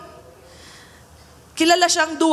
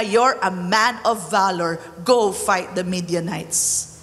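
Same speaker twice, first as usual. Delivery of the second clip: lower, firm divine answer; God commanding; 6 semitones lower than usual. Acted. God answers the frightened Gideon, lower and firm.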